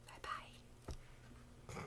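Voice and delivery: unvoiced, teasing whisper; whispered bye-bye. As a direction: breathe a mocking goodbye. Taunting farewell to sensitive viewers.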